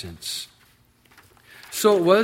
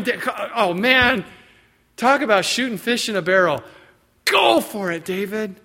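higher, eager urging; excited egging on; 6 semitones higher, 6 dB louder than usual. Acted. Soldiers excitedly urging David on. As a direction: cheer him to strike.

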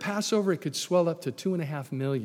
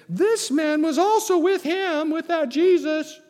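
high, loud, drawn-out accusation; accusing servant girl; 13 semitones higher, 6 dB louder, slower than usual. Acted. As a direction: point him out in a raised, slow voice. Servant girl accuses Peter, acted.

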